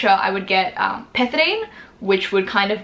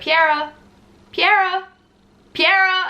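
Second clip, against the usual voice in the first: calling her name; 10 semitones higher, slower than usual. Retold or imitated. Nurse calling a drugged patient's name to wake her, higher. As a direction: rouse someone half asleep.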